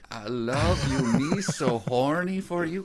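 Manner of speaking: hammed-up flattery. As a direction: overdo a cheesy come-on. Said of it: "hammed flattery line".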